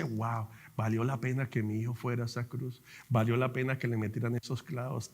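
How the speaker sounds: low, soft, hushed reflection; moved father. Retold or imitated. God the Father reflecting on his son's sacrifice, low tender voice.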